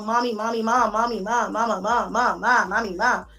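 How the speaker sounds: put-on monotone chant of a pestering child; relentless droning little-kid nagging, imitated. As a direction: mimic kids repeating themselves endlessly. Mimics kids nagging for mom.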